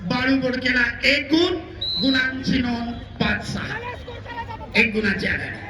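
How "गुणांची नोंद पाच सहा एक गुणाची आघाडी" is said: ordinary talk, nothing marked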